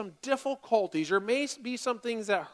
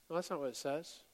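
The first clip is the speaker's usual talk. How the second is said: quiet correction; 6 semitones lower, 11 dB quieter than usual. Real, not acted. Quiet correction after mock misquote.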